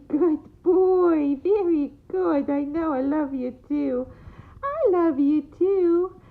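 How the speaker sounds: put-on high, loud baby talk. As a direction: gush over the dog like a baby. Praising the dog.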